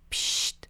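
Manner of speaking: soft whispered shh; shushing. A narrator's reading. Dad signalling silence before sneaking under water, a whispered shush. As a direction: hush them with a secret shh. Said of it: "si dal prst před pusu".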